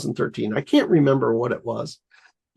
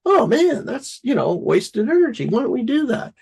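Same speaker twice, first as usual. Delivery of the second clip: pitched up, eager inner thought; excited realization; 8 semitones higher than usual. Retold or imitated. Driving along, the inventor suddenly sees wasted energy, pitched-up excitement. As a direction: catch the idea with a bright lift. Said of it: "I'm like".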